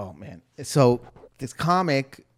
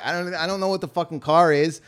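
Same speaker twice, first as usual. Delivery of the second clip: ordinary delivery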